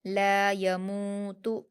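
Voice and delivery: ला यमूतु slowly, long ला and मू; stretched madd. Shown as a demonstration. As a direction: hold ला and मू, keep य and तु short in ला यमूतु. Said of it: "दो हरकत खींचेंगे".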